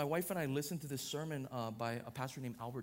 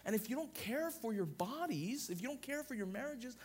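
raised, rhythmic preaching cadence; pitched-up pulpit delivery; 7 semitones higher, 3 dB louder than usual. Acted. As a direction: preach it, building line by line. Building toward the entropy point.

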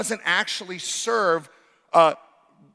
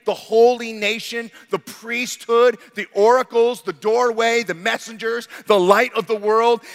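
louder, higher, each phrase punched; preaching crescendo; 4 semitones higher, 6 dB louder than usual. Real, not acted. Preacher to congregation, rising to a shout. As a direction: build the list to a ringing climax.

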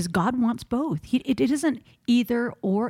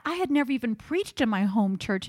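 usual speaking voice

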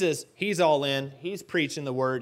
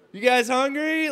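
awkward; 9 semitones higher, 5 dB louder than usual. Pretend. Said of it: "it's awkward".

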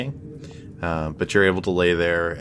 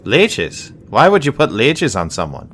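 higher, louder, baffled at leeches; the healer balks at the lizardfolk's leech idea, raised and louder; incredulous healer; 5 semitones higher, 7 dB louder than usual. Acted. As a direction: scoff at the silly suggestion.

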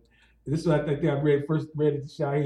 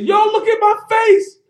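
high loud appeal to witnesses; loud public shout; 10 dB louder than usual. Retold or imitated. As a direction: shout to the whole boat. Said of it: "Shaheed said".

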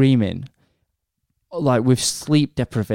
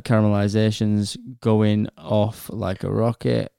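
ordinary talk

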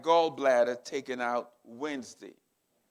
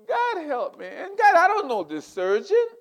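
pleading prayer; 12 semitones higher, 6 dB louder than usual. Retold or imitated. Retold prayer on his knees.

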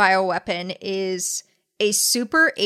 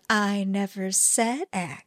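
slow, softer mock-innocent denial; coy denial; 5 dB quieter, slower than usual. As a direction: imitate someone slyly dodging, drawing it out. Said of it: "not technically say it".

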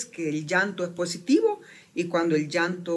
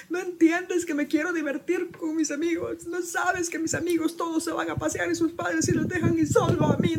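higher whiny child imitation, pleading; whining teenager; 9 semitones higher than usual. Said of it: "todo eso es decir".

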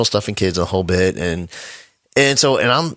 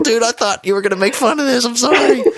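very high, laughing squeal; mock startled apology. Buddy apologizing to his crying friend, mock startled squeal. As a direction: imitate a flustered buddy, pitched way up.

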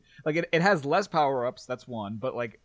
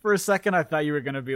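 ordinary delivery

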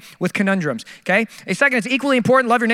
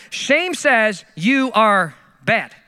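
very slow definition; much slower than usual. Shown as a demonstration. Defining shame.

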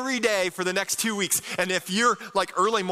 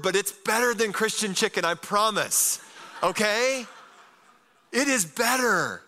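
ordinary talk

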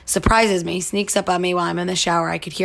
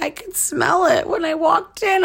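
lightly voiced boyfriend, pitched higher; a hint of a teasing boyfriend; boyfriend's voice; 10 semitones higher than usual. Boyfriend telling her he smelled it, lightly voiced.

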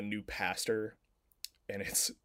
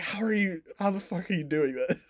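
higher, louder baffled demand; amazed disbelief; 8 semitones higher, 4 dB louder than usual. Retold or imitated. Reacting to Brits' spot-on American accents, amazed. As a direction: re-enact your amazement, pitched up high.

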